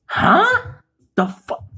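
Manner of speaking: high, baffled huh; gaping audience. Retold or imitated. Audience members with mouths open, high confused huh. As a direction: jaw dropped, confused. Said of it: "people's mouths was open like".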